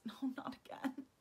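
breathless whisper in dismay; frightened whisper. Acted. Alex whispering in dread at her glowing hands.

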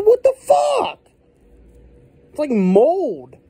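high, loud shocked disbelief; disgusted surprise. Real, not acted. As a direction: recoil at something gross. Sees mold-like dip.

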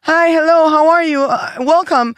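high, bright mock English greeting from airport staff. Airport staff greeting a traveler they think is foreign, mock cheerful English. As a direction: chirp it like an overly friendly gate agent. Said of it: "siempre era como".

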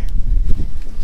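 breathy laugh after a bump; pained laugh. Real, not acted. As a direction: laugh off the knee knock. Laughs after hurting knee.

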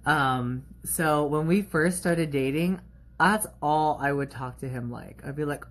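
ordinary talk

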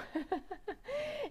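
soft, high-pitched giggle; real giggle. Real, not acted. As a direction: burst of light amused laughter. Amused by trolls while live.

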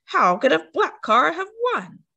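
hammed-up indignant roar. A narrator's reading. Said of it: "they roared".

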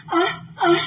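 very high wailing cries; mock ghost wail. Streamer answers a viewer with a high mock Llorona wail.

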